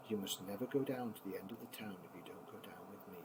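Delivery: quick breathy bedtime whisper. Acted. Grandpa whispering the ending to the grandchildren at bedtime.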